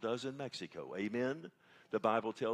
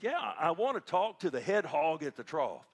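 higher, louder imitation of a country Texan; countrified Texas voice; 6 semitones higher, 8 dB louder than usual. Caller repeating his request to the receptionist, loud countrified Texas voice. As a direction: insist in a big put-on Texas voice. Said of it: "and he repeated".

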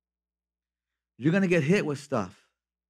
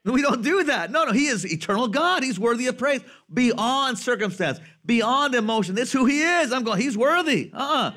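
preaching at full force; 8 semitones higher, 3 dB louder than usual. Acted.

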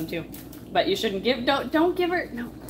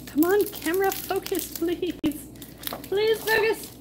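high-pitched plea; exasperated, high begging; pleading; 9 semitones higher, slower than usual. Real, not acted. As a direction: beg the camera in a raised pitch. Pleading with camera to focus.

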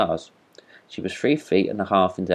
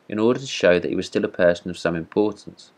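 usual speaking voice

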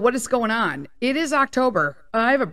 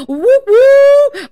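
loud, high celebratory woo; excited whoop; 14 dB louder than usual. Real, not acted. Host thrilled to record in her studio, loud whoop. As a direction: cheer like you just got your studio back. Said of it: "I'm actually recording in my studio today".

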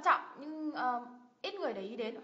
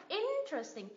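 interesting with IN raised like a rising tone mark; high, long IN at the start of interesting; stress first syllable; 5 semitones higher, 4 dB quieter than usual. Shown as a demonstration. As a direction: lift the first beat, 'IN-teresting', then fall. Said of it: "Giống như kiểu mình thêm dấu sắc".

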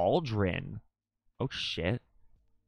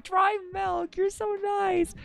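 gushing thanks; slower than usual. Real, not acted.

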